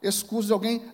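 high, loud, slow preaching build; full-force preaching. Acted. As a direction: preach it hard from the pulpit, drawing out each phrase.

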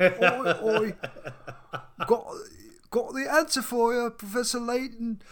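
put-on high, loud, overdone Cockney British accent; mocking the British voice actor's accent, loud and high; bad British accent. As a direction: ham up a terrible British kid accent. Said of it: "Luke talks like with this horrible British accent".

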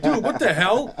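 high, loud startled question. Retold or imitated. Retelling the old man's words, startled and loud. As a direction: blurt it out in shock. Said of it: "It's like".

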